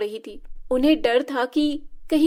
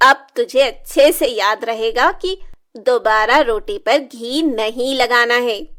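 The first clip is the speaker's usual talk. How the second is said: cruel gloating; 6 dB louder than usual. Acted. Stepmother gloats after burning.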